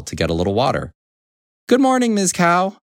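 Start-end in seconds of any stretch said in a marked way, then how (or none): 1.7-2.8 high-pitched hen greeting; cheery hen character. A narrator's reading. Little Red Hen greeting Ms. Cow, high character voice.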